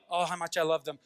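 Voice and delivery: tender affection. Acted. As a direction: drop down into fond love. God swinging back to love.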